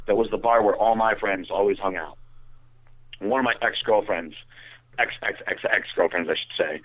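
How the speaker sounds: usual speaking voice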